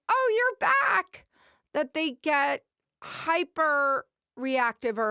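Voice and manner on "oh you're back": high, loud, drawn-out delighted greeting; excited burst. Acted. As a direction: burst out like an overexcited puppy greeting its owner. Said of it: "such an excited burst of".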